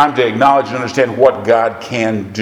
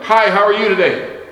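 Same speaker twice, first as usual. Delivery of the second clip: bright friendly hello, pitched higher; cheery greeting; 9 semitones higher than usual. Retold or imitated. Preacher greeting a dog walker, cheery and high. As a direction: call out a cheerful hi from the car. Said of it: "I said to this lady".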